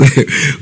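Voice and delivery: a short burst of real laughter; amused laugh while reading. Real, not acted. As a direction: laugh out at what he said. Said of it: "I'm laughing".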